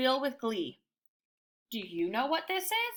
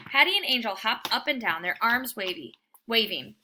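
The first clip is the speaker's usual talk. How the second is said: ordinary delivery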